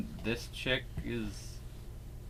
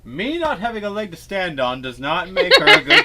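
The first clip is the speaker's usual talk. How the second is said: high, loud arguing over the other; raised, high-pitched insistence; loud rebuttal; 10 semitones higher, 14 dB louder than usual. Real, not acted. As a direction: shout back your counterargument. Shouting back in playful argument.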